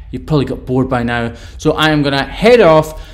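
normal talking voice

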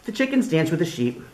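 lively storybook voice with big swings; reading to children. Acted. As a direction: read it like story time for little kids. Reads picture book aloud.

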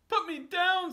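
very high, loud squeal; panicked fish. Acted. Dangled fish begs the cat, squealing high and loud. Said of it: "said the fish".

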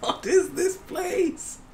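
high, slow incredulous laughing; amazed. Real, not acted. Gamer slowly marvelling high at a giant cat. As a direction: drawl it in disbelief.

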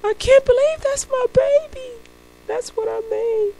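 put-on proud dad marvelling at his child in a high voice; awed new father. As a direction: gush over the baby in disbelief. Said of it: "He's like".

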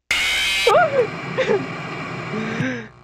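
high-pitched falsetto laugh. Acted. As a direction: cackle in falsetto. Laugh after the bit.

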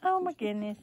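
ordinary talk, nothing marked

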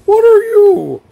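very high, loud shocked squeal; a high falsetto what are you; startled squeak. Real, not acted. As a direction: jump out of your skin at the monster. Startled by a tall creature.

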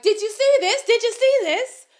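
rally the crowd, outraged; high, loud indignant cry; shrill appeal. Retold or imitated.

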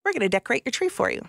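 lightly imitating an upbeat friend, high and fast; excited friends. Friends offering to decorate her tree, lightly mimicked as eager. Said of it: "My friends came over and said".

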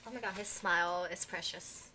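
ordinary talk, nothing marked

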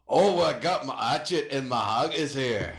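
hammed-up, deliberately awful Scottish accent; bad Scottish accent. Podcaster shows off the worst accent they have, bad Scottish accent. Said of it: "I'm gonna go with Scottish".

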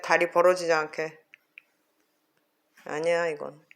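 normal talking voice

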